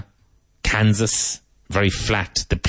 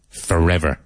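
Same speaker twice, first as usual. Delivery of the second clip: usual speaking voice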